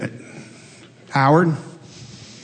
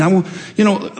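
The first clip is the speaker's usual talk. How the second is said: ordinary talk, nothing marked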